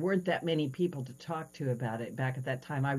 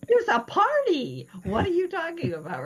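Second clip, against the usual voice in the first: indignant protest; 6 dB louder than usual. Retold or imitated. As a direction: plead that the party is there. Protesting to Jesus.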